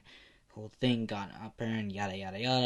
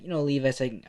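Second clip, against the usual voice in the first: ordinary delivery, nothing marked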